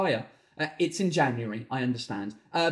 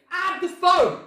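high, loud outburst; exasperated yell; 12 semitones higher, 5 dB louder, slower than usual. Retold or imitated. Husband ordering wife to register phone, exasperated yell. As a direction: shout it out in exasperation.

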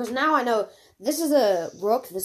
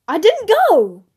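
higher, louder protest; indignant shout; 10 semitones higher, 12 dB louder than usual. Real, not acted. Arguing with her friend over turns, loud protest. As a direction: insist hard she skipped her turn.

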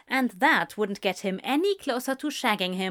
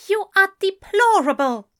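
much higher, loud scolding cry; indignant outburst; 11 semitones higher, 9 dB louder than usual. A narrator's reading. Aziraphale scolding Crowley outside the shop, loud and outraged. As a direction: scold him, stamping with outrage. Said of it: "stomping his foot".

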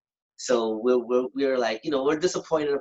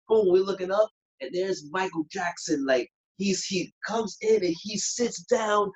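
usual speaking voice

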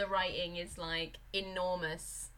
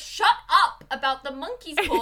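louder, exasperated telling-off; snappy wife; 5 semitones higher, 6 dB louder than usual. Retold or imitated. Podcaster voicing the wife snapping at her husband, loud. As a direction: shut him down impatiently. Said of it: "she's like".

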